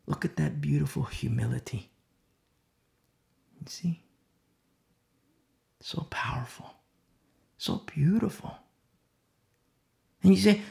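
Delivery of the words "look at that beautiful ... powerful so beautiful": soft, whispered wonder; hushed awe. Real, not acted. Preacher marvels to the congregation, in a hushed whisper. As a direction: breathe it out in amazement.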